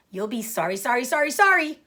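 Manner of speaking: rising to a high, loud goose call. Acted. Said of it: "called the goose".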